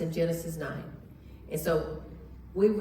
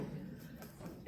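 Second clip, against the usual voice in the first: throat clearing; 16 dB quieter than usual. Real, not acted. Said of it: "I'm gonna need some water".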